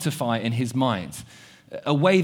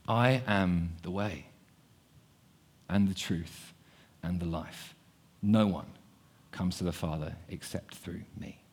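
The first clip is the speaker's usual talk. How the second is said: Jesus gently reassuring Thomas; calm assurance; 4 semitones lower, 7 dB quieter than usual. Acted. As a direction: answer the doubter tenderly. Jesus answering Thomas calmly.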